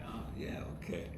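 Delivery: low, soft, sinking reply; deflated. Retold or imitated. Writer called to the boss's office, quiet and deflated. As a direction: say it quietly, sensing trouble. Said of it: "I'm like".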